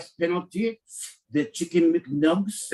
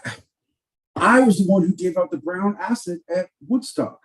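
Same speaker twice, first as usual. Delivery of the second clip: ordinary delivery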